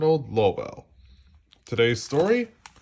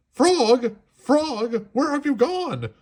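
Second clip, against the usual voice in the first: panicked calling; 14 semitones higher, 4 dB louder, slower than usual. A narrator's reading. Toad panicking in the dark.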